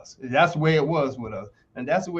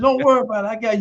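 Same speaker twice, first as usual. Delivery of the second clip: confident reply; 6 semitones higher, 3 dB louder, much faster than usual. Retold or imitated. Self answering coach, retold.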